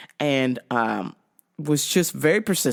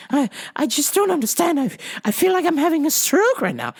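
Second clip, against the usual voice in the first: high, flustered English caller; hammed-up English customer in a fluster; phony English accent; 8 semitones higher than usual. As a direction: plead urgently in fake English. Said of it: "phony English accent".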